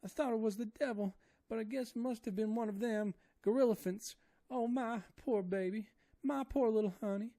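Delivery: high, loud wailing maid voice; terrified woman begging to die, high wailing; crying Esmeralda. A narrator's reading. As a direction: wail in despair with eyes shut. Esmeralda's voice held.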